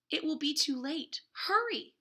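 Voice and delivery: rising urgency, calling out hurry; storyteller urging a tiny seed to hurry; urgent. A narrator's reading. Urgent plea in read-aloud story.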